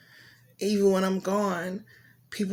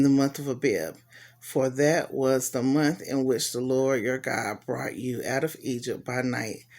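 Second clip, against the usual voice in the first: lower, measured Bible reading voice; scripture reading; 4 semitones lower than usual. Acted. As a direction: read the passage out steadily to the listeners. Reads scripture passage aloud.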